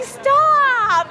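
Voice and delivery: mock protest. Retelling protest to Jensen.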